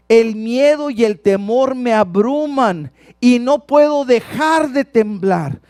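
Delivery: high, loud preacher declaiming; slowing and heavy on the last phrases; impassioned scripture reading. Acted. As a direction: read it out with urgent force. Dramatic reading of David's fearful psalm.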